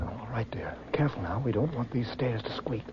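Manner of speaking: whispered, creeping up the stairs. Acted. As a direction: hush it so the burglar can't hear. We don't want these stairs to squeak.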